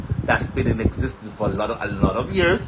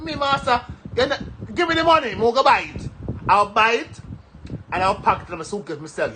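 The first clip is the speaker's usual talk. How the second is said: mock retort; 10 semitones higher than usual. Retorting to people at airport.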